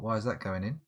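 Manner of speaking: ordinary talk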